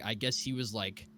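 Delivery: usual speaking voice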